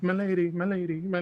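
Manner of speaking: high, loud lovestruck calling; swooning suitor. Retold or imitated. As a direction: call after her like a smitten lover. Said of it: "he's just like".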